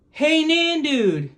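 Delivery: high, slow, happy call; children hailing grandma from the garden. A narrator's reading. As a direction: call out a glad greeting. Calling out to Nandude.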